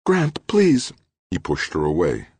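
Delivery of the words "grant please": much higher, louder plea; pleading. A narrator's reading. Trudy begging Grant to stop, much higher. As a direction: beg him to stop.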